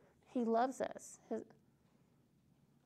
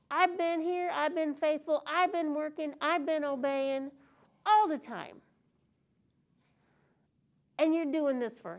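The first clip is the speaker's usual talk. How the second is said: loud, higher complaining rant; angry older son; 4 semitones higher, 3 dB louder than usual. Acted. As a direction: vent like a wronged son. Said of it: "And he was mad. He's fed up".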